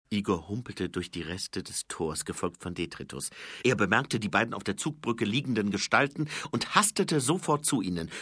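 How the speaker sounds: ordinary delivery, nothing marked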